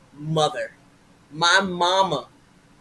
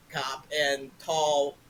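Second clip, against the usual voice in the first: usual speaking voice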